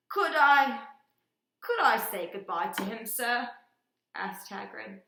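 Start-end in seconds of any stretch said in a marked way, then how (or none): 0.1-3.5 loud, high, pleading giant; big gruff giant begging loudly; booming giant voice. Acted.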